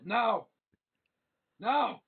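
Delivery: monk master ordering the trainees to obey; shouted command. Acted. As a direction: snap the order at the class.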